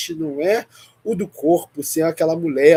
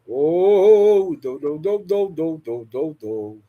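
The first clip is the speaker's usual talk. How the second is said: ecstatic tongues; slower than usual. Real, not acted. Intercessor overcome in live prayer, ecstatic speaking in tongues.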